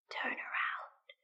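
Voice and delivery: slow, soft eerie whisper from far off; ghostly whisper. Acted. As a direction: breathe it like an unseen thing. Unseen voice whispers command.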